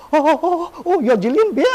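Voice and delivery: high, gasping shock of recognition; hammed-up starstruck outburst, pitch leaping up; starstruck astonishment. Re-enacts her own starstruck realisation.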